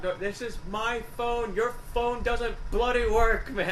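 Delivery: high, drawn-out Irish rant; hammed-up indignant Irish drunk; mock pissed off. Retelling drunken phone row, mock pissed-off Irish. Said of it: "I go all pissed off".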